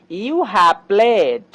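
very slow, stretched out; slow model sentence. Shown as a demonstration. Teacher slowly modelling the English example. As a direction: lay each word down for learners. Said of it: "যেমন".

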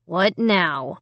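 usual speaking voice

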